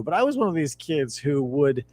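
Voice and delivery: usual speaking voice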